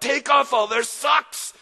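high, loud battle command; shouted order. Acted. Dirty Joe commanding the raid, shouting. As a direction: bellow it across the deck. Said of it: "Joe cried out".

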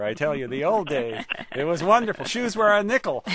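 put-on light Yiddish accent, old-timer nostalgia; reminiscing grandpa with a Yiddish accent. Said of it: "developing a Yiddish accent, apparently".

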